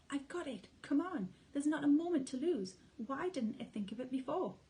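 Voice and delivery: high, loud eureka; father fox shouting his idea; excited shout. A narrator's reading. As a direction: burst out with the idea. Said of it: "He jumped up and shouted".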